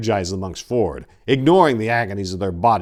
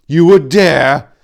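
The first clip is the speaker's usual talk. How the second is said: menacing; 9 semitones higher, 14 dB louder, slower than usual. A narrator's reading. Threatening in guard position.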